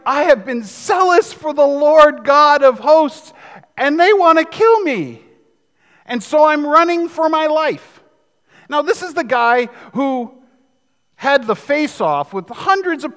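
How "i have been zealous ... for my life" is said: Elijah's lament. Retold or imitated. Preacher voicing Elijah, a desperate prophet on the run. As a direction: cry out like a hunted prophet.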